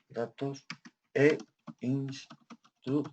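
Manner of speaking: soft, very slow syllable by syllable; quiet, drawn-out typing pace with gaps; slow dictation. Real, not acted. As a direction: murmur each piece as you type it. Dictates aloud while typing.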